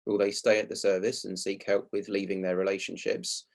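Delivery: ordinary talk, nothing marked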